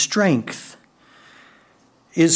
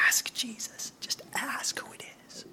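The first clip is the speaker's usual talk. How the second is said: hushed urging whisper; secretive nudge; 9 semitones higher, 17 dB quieter than usual. Retold or imitated. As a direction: whisper it behind your hand. Peter prodding John at supper.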